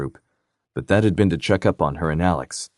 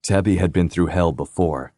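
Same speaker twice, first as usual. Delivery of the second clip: usual speaking voice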